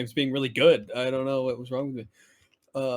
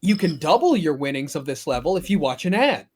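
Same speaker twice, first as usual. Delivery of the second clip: high, loud cheery game voice; cheery game announcer; 6 semitones higher, 6 dB louder than usual. Retold or imitated. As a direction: announce the win with fake cheer. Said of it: "it's gonna go".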